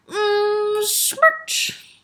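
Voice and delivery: high squeezed "mmm" into a smack; exaggerated smooch sound; big kiss noise. A narrator's reading. Taya kissing her father, smooching.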